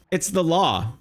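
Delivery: ordinary talk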